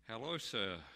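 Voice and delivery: a lightly put-on Irish brogue on the phone; Paddy's cheery Irish greeting, lightly voiced; Irish accent. Paddy the Irish publican ringing the dictator, light Irish accent. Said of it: "this heavily accented voice said".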